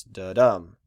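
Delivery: "da-dum" with the second syllable stressed; iambic stress. Shown as a demonstration. Teacher shows students the iamb's feel, second syllable stressed. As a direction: punch "dum" in "da-dum", leaving "da" light. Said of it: "second syllable is stressed the most".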